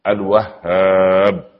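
الوهاب drawn out very slowly with a doubled ه; doubled h in Wahhab. Shown as a demonstration. Preacher teaching the name's shadda, slowly leaning on it. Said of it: "في شدة".

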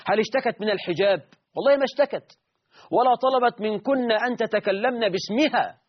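indignant, pitch lifted throughout; indignant challenge. Real, not acted. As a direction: demand answers from the opponents, exasperated. Indignant rhetorical questions to opponents.

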